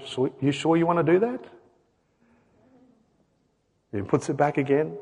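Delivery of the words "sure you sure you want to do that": soft, questioning parent voice; quiet parental check. Retold or imitated. Father checks his little kid at the shop, quiet parental check. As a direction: gently query the child, voice lowered. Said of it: "and I say".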